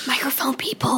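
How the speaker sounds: hushed whisper to the mics. Pretend. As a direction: whisper it like a secret.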